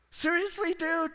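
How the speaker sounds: high-pitched incredulous outburst; exasperated disbelief. Acted. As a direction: squeal your disbelief at him. Mock exasperation at Samson.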